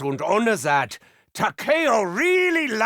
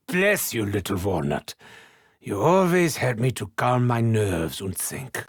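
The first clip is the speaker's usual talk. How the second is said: 1 tender coo; 11 semitones lower than usual. Acted. Talking fondly to a brain.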